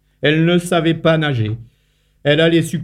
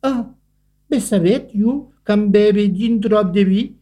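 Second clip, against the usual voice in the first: high, light ant voice, offhand; sheepish little ant; 7 semitones higher than usual. A narrator's reading. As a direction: shrug it off like a tipsy little ant.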